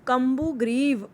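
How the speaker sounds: normal talking voice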